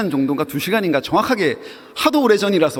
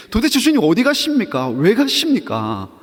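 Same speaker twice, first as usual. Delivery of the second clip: pressing question; 6 semitones higher, 4 dB louder than usual. Retold or imitated. Imagined disciples question Jesus.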